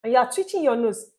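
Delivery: ordinary delivery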